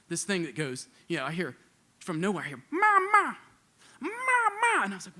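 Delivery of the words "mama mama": high, loud imitated yell calling for mom; yelling mama. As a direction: yell it high and loud like a lost child across the airport. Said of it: "He's yelling that".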